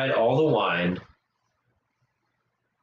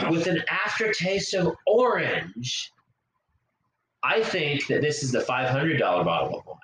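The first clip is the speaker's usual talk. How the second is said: ordinary delivery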